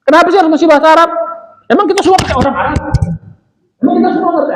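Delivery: imitating a skeptic. Teacher mimics people asking why Arabic, high and fast. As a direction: mimic a doubter, high and quick. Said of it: "ada orang ngomong".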